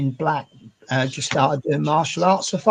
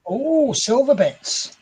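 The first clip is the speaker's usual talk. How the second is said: drawn out slowly with longing; slow savouring; 7 semitones higher, much slower than usual. Real, not acted. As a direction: linger on it fondly. Savouring a remembered sweet.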